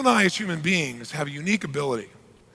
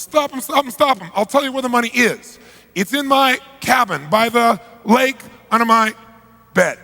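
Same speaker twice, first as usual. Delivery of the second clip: higher, louder, rushed panic; panicked pleading; 6 semitones higher, 5 dB louder than usual. Retold or imitated. Accountant at gunpoint begging, higher panicked plea. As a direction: blurt out the confession in terror. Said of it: "He goes".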